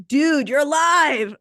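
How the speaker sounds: loud, high, drawn-out cheer imitating relatives; mock whoop. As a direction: call it out big and slow, hamming up their reaction. Retold relatives joking, whooping over her.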